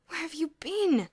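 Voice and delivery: slow, high, loud moan. A narrator's reading. George moaning to Boots from his sickbed. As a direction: moan it like a dying man. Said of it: "George moaned".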